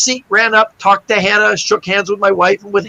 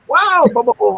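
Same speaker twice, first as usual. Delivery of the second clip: mock freaking out; 4 semitones higher than usual. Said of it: "my wife freaks out going".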